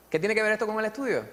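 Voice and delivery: pregunta escéptica, aguda y rápida; objeción escéptica. Retold or imitated. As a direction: burlando una objeción incrédula. Imagined skeptical objection. (imitando a quien duda del valor del gesto)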